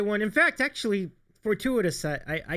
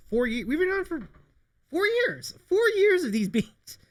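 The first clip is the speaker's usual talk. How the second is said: exasperated; 13 semitones higher than usual. Real, not acted. Exasperated about years of beans.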